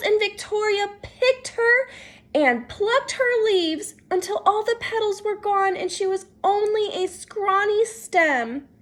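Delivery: plain reading voice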